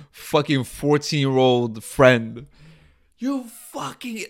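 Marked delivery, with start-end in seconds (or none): 3.2-4.3 retold angry scream. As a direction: imitate a boss screaming, volume held back. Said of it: "he's just screaming at my".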